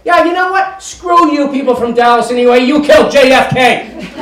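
high, loud mock shout; hammed-up yell at the audience; mock angry outburst. Fictional speaker yelling at Dallas crowd, mock fury. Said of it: "You can say".

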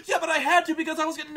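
put-on high, loud, fast frantic teen; breathless panic, words tumbling out; panicked girl. Girl running screaming to her dad, high frantic panic. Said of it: "she's like".

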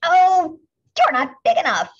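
high, loud dismissive brush-off; scornful ladybug. A narrator's reading. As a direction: wave them off with contempt. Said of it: "said the grouchy ladybug".

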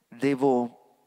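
ordinary talk

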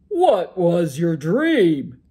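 a sleepy giant ogre grumbling to his wife; loud ogre brushing off his wife's worry. Acted. Ogre asks about dream.

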